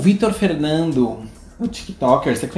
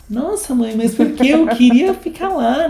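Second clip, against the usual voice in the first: imitating the son; 8 semitones higher, slower than usual.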